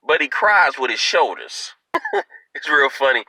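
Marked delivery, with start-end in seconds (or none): none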